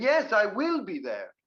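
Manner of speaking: high, emphatic "Yes, I will"; insistent stress on WILL. Shown as a demonstration. Answering someone who doesn't believe you, emphatic "will". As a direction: punch "will" as if not believed. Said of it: "I think you don't believe me".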